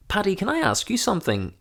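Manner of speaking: high-pitched imitation of a posh friend; Mark, polite and posh. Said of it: "he's so polite and posh".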